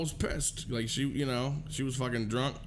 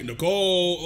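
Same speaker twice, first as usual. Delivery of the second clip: mock search call, a bit louder; 6 dB louder than usual. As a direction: imitate calling for someone missing. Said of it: "they weren't even calling her".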